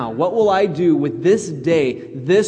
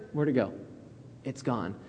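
hushed puzzlement; 4 semitones lower, 11 dB quieter than usual. Acted. Acts out steam vanishing.

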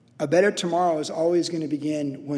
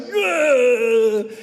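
higher, louder jolted grunt; startled grunt; 6 semitones higher, 4 dB louder than usual. Retold or imitated. Old David waking at the name Joab, jolted grunt. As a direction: jolt awake with a grunt. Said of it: "he'd be like".

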